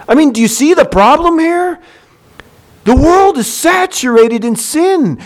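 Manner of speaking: ordinary talk, nothing marked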